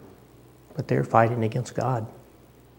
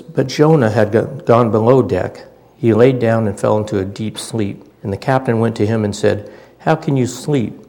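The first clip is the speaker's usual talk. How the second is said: louder, ringing Bible reading from the pulpit; scripture reading; 7 dB louder than usual. Acted. Preacher reads the Jonah story aloud to his church, proclaiming loudly. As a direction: proclaim the passage to the whole room.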